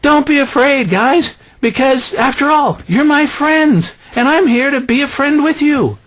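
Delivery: sugar-coat it like a pal; mock buddy Jesus. Preacher parodying a chummy Jesus to disciples, high and sweet. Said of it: "He didn't say".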